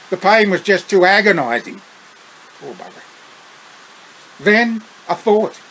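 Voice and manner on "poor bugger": much softer, lower pity; quiet aside. A narrator's reading. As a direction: murmur it with pity. Sympathetic aside.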